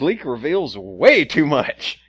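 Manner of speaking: loud, slow teaser announcer; mock trailer voice. Host teasing the episode, mock announcer voice.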